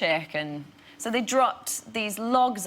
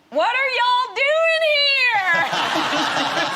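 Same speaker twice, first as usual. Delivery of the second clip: very high, loud, drawn-out Southern squeal; Southern accent; 8 dB louder, slower than usual. Retold or imitated. Local recognises the actress, shrieking in Southern accent. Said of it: "sounds a little bit Midwest and Southern".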